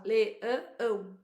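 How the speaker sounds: "leão" in three pieces, le-ã-ão; son's way of counting le, ã, ão; split in three. Shown as a demonstration. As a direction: break "leão" up with an extra nasal beat. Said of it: "O meu filho, ele fala".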